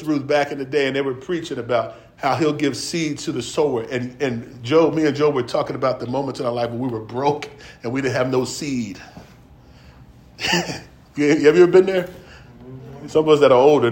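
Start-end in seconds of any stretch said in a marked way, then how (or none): none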